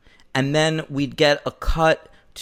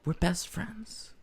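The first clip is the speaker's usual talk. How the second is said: very soft, halting declaration; tender, earnest; 11 dB quieter, slower than usual. Retold or imitated. JCVD to his friend in bed, soft and sincere. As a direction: let it out slowly and quietly.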